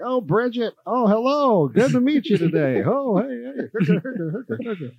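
high, swooping mock radio greeting; Steve Hartline radio impression. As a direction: gush like a hammy local announcer. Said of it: "I was doing it".

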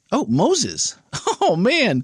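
high, laughing groan of recognition; amused dismay. Real, not acted. Host finding next week's topic is Moses, laughing dismay. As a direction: laugh through the sudden realisation.

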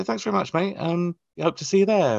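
high, chirpy acted thanks; high-voiced mock politeness; friendly thanks. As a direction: pitch it up like a keen promoter. Acts out thanking takeaway staff.

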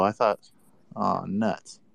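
ordinary talk

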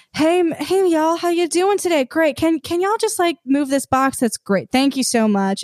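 high, sugary Southern charm; Southern Belle. Retold or imitated. Asking the stagehands a favour, high and sweetly Southern Belle. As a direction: sweet-talk them into moving the box. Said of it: "Southern Belle kinda demure thing".

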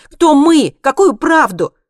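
high, loud bewildered questions; exclaiming. A narrator's reading. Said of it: "воскликнула Монти". (громко, высоким голосом восклицая)

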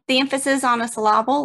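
"syllable" said as suh-LAH-bull; "syllable" with a stressed LAH in the middle; wrong-syllable stress. Shown as a demonstration. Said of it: "emphasis on the wrong syllable".

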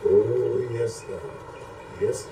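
ordinary talk, nothing marked

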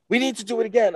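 high, loud, determined urging; excited retold insistence; urgent insistence. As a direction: insist on a redo, pitch way up. Retold star insists on redoing.

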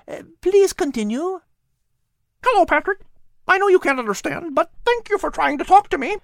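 high, chirpy bird voice; a tiny bird listing its visitors; little bird Pip. Acted. Bird Pip answering the cat in a high chirpy voice.

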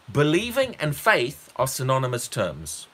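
ordinary talk

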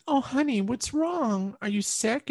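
put-on a mother checking on her sick child; worried mom.

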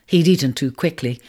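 usual speaking voice

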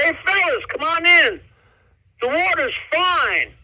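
a hint of a cheery turd calling friends in, slowing at the end; jokey turd voice. Turd character greets the bananas.

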